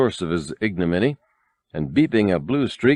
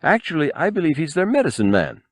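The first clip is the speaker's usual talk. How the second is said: high, fussy protocol droid voice, much higher; prim droid correction; 8 semitones higher than usual. A narrator's reading. The golden droid corrects Han, prim and high. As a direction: correct them primly, pitched up high. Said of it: "three P O corrected".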